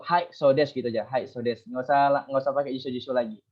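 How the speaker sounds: usual speaking voice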